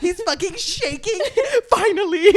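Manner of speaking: squealing high through laughter; cracking up, voice jumping up high; laughing hysterically. Real, not acted. Laughing about the long-awaited kiss.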